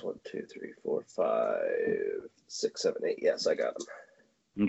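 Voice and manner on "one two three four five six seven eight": counting under breath. Real, not acted.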